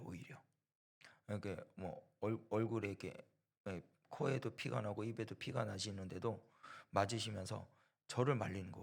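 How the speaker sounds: usual speaking voice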